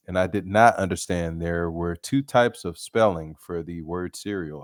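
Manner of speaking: usual speaking voice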